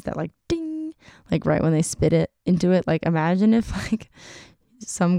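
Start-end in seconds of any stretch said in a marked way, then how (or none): 0.5-0.9 high, ringing imitated "ding"; tin-can ding. Imitating spit hitting a tin can, high sung ding. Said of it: "makes that noise".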